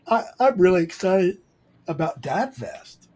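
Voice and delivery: high, stretched yawny voice; talking through a yawn. Real, not acted. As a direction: claim excitement while yawning. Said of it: "I know I'm yawning while I'm saying it".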